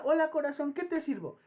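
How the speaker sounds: high, loud, sugary coo; sweet taco lady. Retold or imitated. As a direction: dote on him like a little kid. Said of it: "como si fueras una criatura de poca edad".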